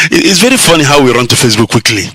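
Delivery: ordinary talk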